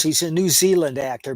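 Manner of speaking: ordinary talk, nothing marked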